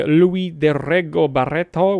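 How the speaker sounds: name said with a light Italian lilt; slight Italian accent. Real, not acted. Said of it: "said that with a slightly Italian accent".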